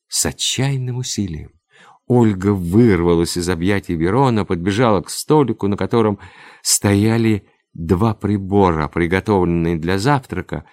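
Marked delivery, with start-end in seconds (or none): none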